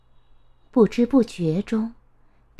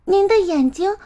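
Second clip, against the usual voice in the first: put-on high child voice, wondering; startled little girl; 8 semitones higher than usual. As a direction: exclaim in surprise, pitched up like a child.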